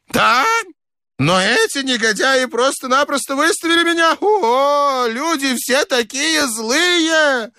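put-on high, wailing old man's complaint; very high-pitched plaintive outburst; aggrieved old man. A narrator's reading. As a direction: lament bitterly in a high voice. Old man bewails his treatment.

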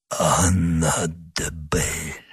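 slow, low, faint whisper of a wounded man. A narrator's reading. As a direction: breathe the name out slowly, very weakly. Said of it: "прошептал раненый".

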